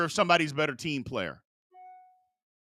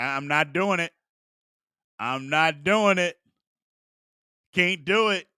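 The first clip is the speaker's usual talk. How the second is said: normal talking voice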